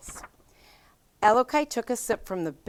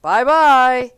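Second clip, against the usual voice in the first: higher, much louder bear calling; loud mother bear calling goodbye; middle-sized bear voice; 6 semitones higher, 13 dB louder than usual. A narrator's reading. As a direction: call out loud to the girl. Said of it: "a middle-sized voice".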